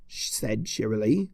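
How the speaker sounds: ordinary delivery, nothing marked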